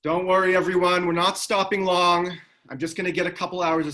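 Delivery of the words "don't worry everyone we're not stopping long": loud, raised voice to a crowd; calling out. Acted. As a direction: call out to the whole bus. Mo calls out to the bus passengers.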